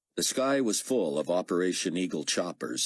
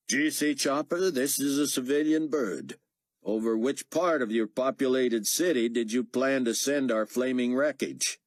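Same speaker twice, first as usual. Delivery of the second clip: sarcastic radio reply; 9 semitones higher, 3 dB louder than usual. Acted. Pilot sarcastic over radio.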